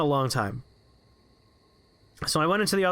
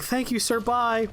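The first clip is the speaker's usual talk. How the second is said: nervous exit; 7 semitones higher than usual. Retold or imitated. Terrified teen backing out.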